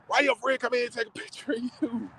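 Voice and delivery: high-pitched mimicry of other people; questioning onlookers. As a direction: imitate people questioning a friend's odd choice. Voicing what some people would say.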